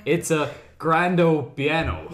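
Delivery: slow mock Italian; slow, hammed-up mock Italian; Italian accent. Shown as a demonstration. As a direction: ham up an Italian showman. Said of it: "my Italiano accent".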